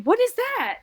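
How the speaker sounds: high-pitched retold bafflement; acting out her own bewildered question; baffled. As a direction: shoot up high in puzzled surprise. Her baffled reaction, retold higher.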